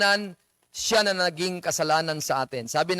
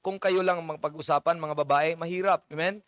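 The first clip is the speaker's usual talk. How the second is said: ordinary talk, nothing marked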